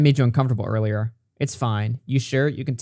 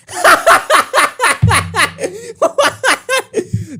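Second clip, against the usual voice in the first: shrieking high laughter; high laughing fit; 3 dB louder than usual. Real, not acted. Reading a cringe DM, shrieking with laughter. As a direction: let the laugh run high.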